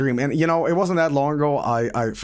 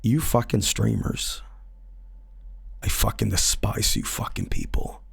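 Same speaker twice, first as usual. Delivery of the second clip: hissed low whisper of a creepy intruder; low breathy menace, quietly contemptuous; menacing whispered stranger; 6 semitones lower, 6 dB quieter than usual. Retold or imitated. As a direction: lean in and whisper the threat. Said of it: "He's like".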